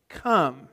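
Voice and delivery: "come" set apart after a pause, falling; emphatic come. A narrator's reading. Preacher reading the verse's key word to the congregation, emphatic. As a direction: land on "come" as a one-word command. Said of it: "put a special emphasis on the word come".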